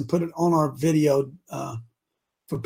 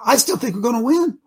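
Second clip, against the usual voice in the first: rising, louder excited declaration; 13 semitones higher, 6 dB louder than usual. Real, not acted. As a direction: burst out with growing excitement. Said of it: "I was excited".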